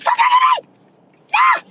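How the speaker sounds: high comic shriek; Kevin Hart scream. Retold or imitated. Redoing her bar scream Kevin Hart style, high shriek. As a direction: shriek like a panicked comedian. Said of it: "I hit a Kevin Hart".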